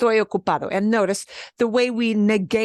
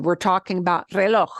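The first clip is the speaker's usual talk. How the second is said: normal talking voice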